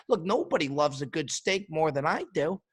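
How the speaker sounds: ordinary talk, nothing marked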